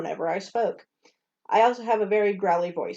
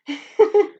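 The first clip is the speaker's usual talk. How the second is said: laughing; 12 semitones higher, 9 dB louder than usual. Real, not acted. Laughing at the cockroach.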